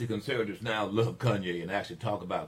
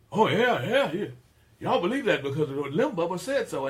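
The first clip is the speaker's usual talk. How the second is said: higher, louder mocking sneer; mock listener voice; 9 semitones higher, 8 dB louder than usual. Host voicing critics who call him a bigot, loud mocking sneer. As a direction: scoff like a know-it-all critic.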